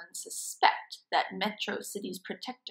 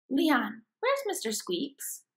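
ordinary delivery

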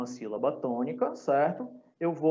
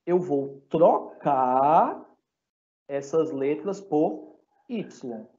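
slow emphatic; much slower than usual. Shown as a demonstration. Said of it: "teacher stretches out key step".

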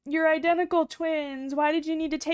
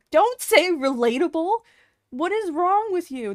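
louder indignant scold; 1 loud mock scold; 2 semitones higher, 6 dB louder than usual. Real, not acted. Streamer scolding chat for saying relatable, loud indignant scold. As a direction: snap back at the chat, loud and incredulous.

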